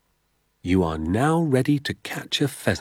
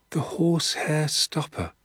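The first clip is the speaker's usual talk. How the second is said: put-on higher, awed boy's echo of the name; murmured echo; 8 semitones higher than usual. Danny echoes his father's method name, high and awed. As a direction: repeat it like a wondering child. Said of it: "I murmured".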